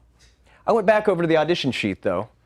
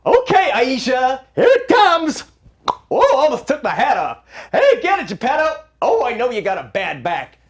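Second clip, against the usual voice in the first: much higher and louder excited calls; calling out in game; 7 semitones higher, 4 dB louder than usual. Acted. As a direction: cheer the kids on. Calling plays in pretend baseball.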